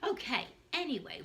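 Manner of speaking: normal talking voice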